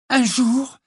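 high, loud, exasperated opening. A narrator's reading. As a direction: launch in at the end of your patience, high and loud. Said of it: "au comble de l'exaspération". (exaspérée, voix forte et aiguë)

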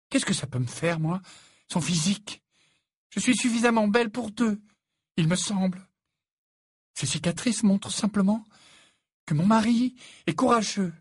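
high, proud indignant tirade; fierce. A narrator's reading. Fiancée claiming the care of her wounded man, fierce. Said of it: "ajouta-t-elle d'un ton féroce".

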